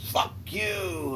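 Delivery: high mocking retort. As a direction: fire it back cheekily. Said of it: "and he goes".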